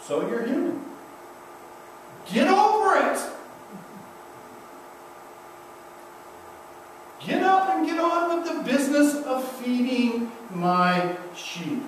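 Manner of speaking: high, emphatic and brisk; a loving Jesus brushing off failure; Jesus telling the disciples to move on; Jesus' voice. Retold or imitated. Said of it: "And here's Jesus saying".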